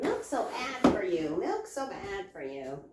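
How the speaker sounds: slow, drawn-out mimicry; mocking naysayers. Milk lover quoting people who object, mocking. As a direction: parrot the critics, stretching the words. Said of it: "people are like".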